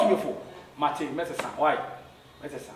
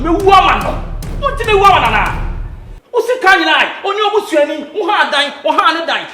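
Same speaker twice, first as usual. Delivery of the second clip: much higher and louder, scolding with bursts of laughter; angry shouting; 8 semitones higher, 8 dB louder than usual. Acted. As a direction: berate him at full volume. Wife berating husband, shouting.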